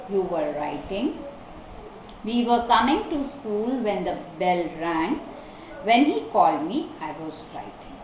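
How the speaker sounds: falling at sentence ends, clear pauses; model reading. Shown as a demonstration. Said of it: "I will repeat all the sentences".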